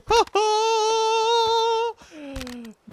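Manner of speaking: high, lilting laugh; sing-song laugh. Real, not acted. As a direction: laugh it out like a tune. Sing-song laugh after the yell.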